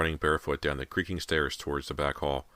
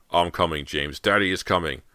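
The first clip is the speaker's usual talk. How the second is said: frantic shout; 7 dB louder than usual. A narrator's reading. Father clawing at wall.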